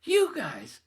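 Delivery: loud, high, drawn-out question rising into a squeal; shocked disbelief. Retold or imitated. Asking the couple about the sign, squealed disbelief. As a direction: build from incredulous to a full comic screech. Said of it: "I get in between them going".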